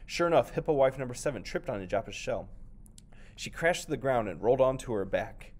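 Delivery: ordinary delivery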